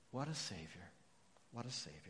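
softly, almost to himself; a hushed, reverent repeat; hushed awe. Real, not acted. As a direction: let it fall away in wonder. Awed repeated exclamation, very soft.

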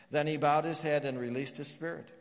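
ordinary talk, nothing marked

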